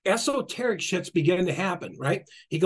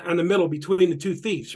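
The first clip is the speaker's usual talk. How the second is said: ordinary talk, nothing marked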